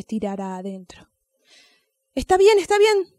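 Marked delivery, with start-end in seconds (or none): 2.1-3.2 high, loud exasperated snap; angry. Acted. Angry snail giving in to the crying dog, loud and high. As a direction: bark it out, fed up and much higher. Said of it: "dijo don Caracol enojado".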